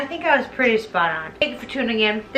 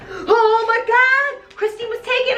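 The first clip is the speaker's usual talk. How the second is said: mock horror; 10 semitones higher than usual. Everybody reacting to Christine being taken, hammed-up horror. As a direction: gasp it out high like a scandalized crowd.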